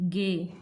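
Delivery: गे said with a hard g, short and clear; hard g demo. Shown as a demonstration. Teacher shows students the stroke name, clear hard g. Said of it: "जी को हम गे pronounce करेंगे".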